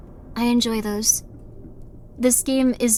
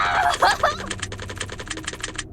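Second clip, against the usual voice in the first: high, breathy shriek of laughter; a high airy laughing shriek; 4 dB quieter than usual. Real, not acted. Shrieking laugh after game breaks.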